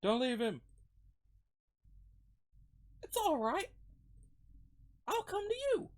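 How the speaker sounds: put-on high, earnest girl voice; reassuring girl character. Acts the girl reassuring him.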